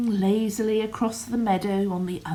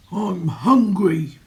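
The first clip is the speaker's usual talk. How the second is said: loud, slow troll grumble; grumbling troll; 8 dB louder than usual. Acted. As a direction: growl it out like a hungry troll. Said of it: "he grumbled".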